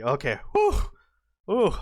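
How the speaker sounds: ordinary talk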